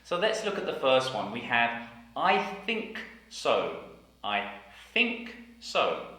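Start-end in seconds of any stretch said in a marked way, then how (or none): 2.2-6.1 I think so, slow, th in think clear; I think so, stressing the th; careful th sentence. Shown as a demonstration. Teacher modelling I think so. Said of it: "sentences with the T H sound".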